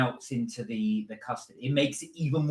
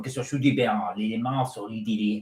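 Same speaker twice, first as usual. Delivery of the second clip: a hint of made-up French song talk, lower; mock French; 5 semitones lower than usual. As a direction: fake French gibberish, lightly done. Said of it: "let me do my, my French thing".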